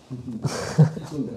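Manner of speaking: a short, soft amused laugh. Real, not acted. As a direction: laugh quietly at the tongue-twister. Laughs after tongue-twister verse.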